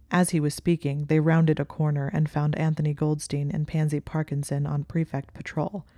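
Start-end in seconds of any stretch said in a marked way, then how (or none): none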